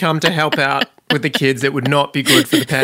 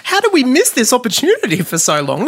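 amazed; 10 semitones higher than usual. Retold or imitated. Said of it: "And I'm like".

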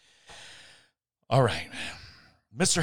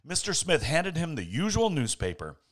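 usual speaking voice

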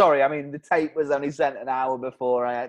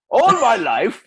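shouted Welsh crooner line; loud Welsh exclamation; 6 semitones higher, 6 dB louder, slower than usual. Acted. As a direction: belt it out in Welsh tones. [name] ad, bursts out.

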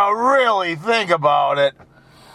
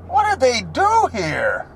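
ordinary talk, nothing marked

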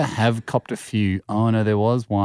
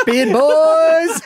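shout; 5 dB louder than usual. Real, not acted. Teasing shout at Jack.

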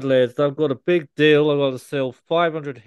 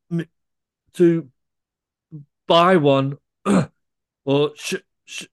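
stammering; 4 semitones higher than usual. Acted. Stammering Bible salesman trick.

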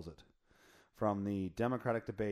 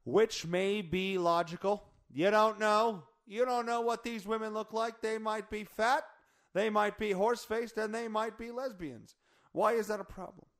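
sarcastic; 10 semitones higher, 5 dB louder than usual. Acted. Mocking the quoted debate line.

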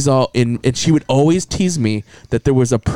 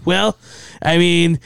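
higher-pitched imitation of a hedging teen; teenage clinic caller; 6 semitones higher than usual. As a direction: play a teenager downplaying it, pitch up. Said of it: "teenager calls me back".

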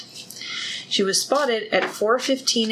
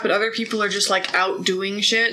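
normal talking voice

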